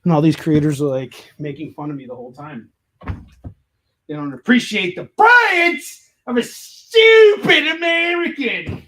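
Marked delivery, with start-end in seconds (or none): 3.1-8.8 high, slow dopey voice; mock dumb American. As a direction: play the stupid American, dragged out. Mocking himself as dumb American.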